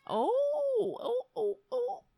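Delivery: high mock crying oh-oh-oh; theatrical high-pitched whimper at the cat; mock wail. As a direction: wail it high like a fake cry.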